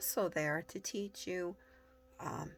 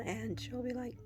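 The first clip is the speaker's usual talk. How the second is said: ordinary delivery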